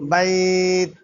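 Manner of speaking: বাইত with only a touch of থ at the end; just a whiff of breath after ত in বাইত; hint of থ. Shown as a demonstration. Teacher shows a slight থ flavor to students. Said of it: "কিছুটা গন্ধ আসবে".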